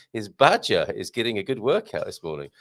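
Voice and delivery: ordinary talk, nothing marked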